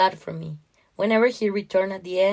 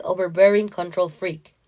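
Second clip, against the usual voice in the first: usual speaking voice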